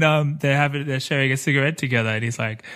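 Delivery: normal talking voice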